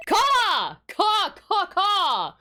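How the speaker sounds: loud, high cawing like a crow; crow caws. Acted. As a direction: caw out like a demon crow.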